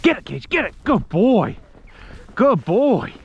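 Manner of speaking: high eager dog encouragement; talking to a dog. Real, not acted. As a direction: urge then praise the dog. Urging and praising dog.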